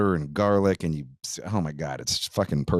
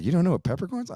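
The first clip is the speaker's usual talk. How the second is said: mock disbelief; 12 semitones higher, much faster than usual. Drunk bandmate incredulous, mimicked.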